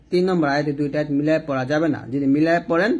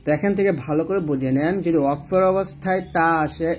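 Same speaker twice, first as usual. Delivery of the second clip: usual speaking voice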